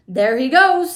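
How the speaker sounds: loud excited shout. A narrator's reading. Onlooker spotting boy in pipe, loud shout. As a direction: shout it out to the crowd. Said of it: "somebody shouted".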